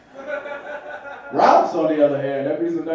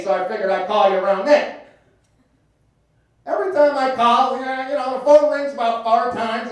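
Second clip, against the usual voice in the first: Uncle Mike voice; 5 semitones higher, 4 dB louder than usual. Retold or imitated. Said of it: "Uncle Mike quote continues".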